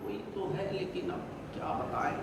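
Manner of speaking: imitating a hushed, evasive reply; very soft murmur. Preacher mimics worshippers dodging his question, very quiet. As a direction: answer very softly, barely audible. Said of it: "تو بڑے دھیرے سے بولیں گے".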